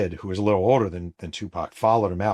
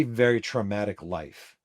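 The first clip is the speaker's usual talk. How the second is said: plain reading voice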